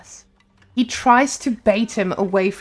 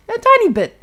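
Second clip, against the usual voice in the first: teasing; 12 semitones higher, 4 dB louder than usual. Real, not acted. Teasing understatement.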